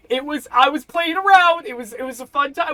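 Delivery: high, loud frantic pleading; panicked wizard backpedaling. Acted. Wizard insisting to Elias it was only a joke. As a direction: babble excuses fast like a caught wizard.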